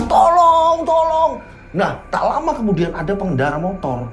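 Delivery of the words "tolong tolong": high shouted tolong; calling for help. Acted. Riko screams for help by the road, high calls. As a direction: yell for help at the roadside. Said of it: "teriak-teriak di pinggir jalan".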